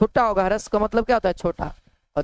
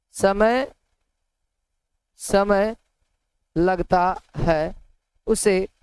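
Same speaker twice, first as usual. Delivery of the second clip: slow dictation; slower than usual. Shown as a demonstration. Teacher dictating the definition of ह्रस्व स्वर, slow. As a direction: dictate each word with long gaps, slowly. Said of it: "teacher dictating a definition".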